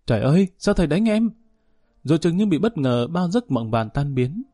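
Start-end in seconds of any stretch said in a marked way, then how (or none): 0.1-1.3 startled cry. A narrator's reading. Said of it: "giật mình tỉnh mộng chu chéo lên".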